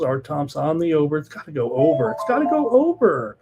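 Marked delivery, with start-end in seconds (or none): 2.1-3.4 pitched well up, falling at the end; emphatic repeat. Shown as a demonstration. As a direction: repeat the call in a much higher voice.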